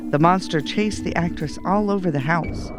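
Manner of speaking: ordinary delivery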